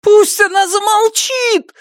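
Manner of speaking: high shriek breaking into a squeal; screaming, voice cracking. Acted. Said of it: "закричал Платон, и голос его сорвался на визг".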